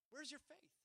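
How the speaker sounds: usual speaking voice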